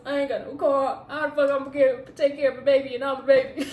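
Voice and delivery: laughing in disbelief at her own panic; laugh-crying. Retold or imitated. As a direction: panicky laugh through tears. Panicked pregnancy thoughts, laughing cry.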